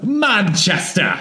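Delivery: high, slow hammy English cry; shitty English accent. Pretend. Calling out the city's name for fun, mock English accent. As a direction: draw it out like a bad Brit. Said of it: "in our shitty English accents".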